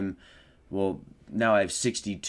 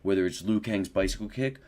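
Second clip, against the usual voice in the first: ordinary talk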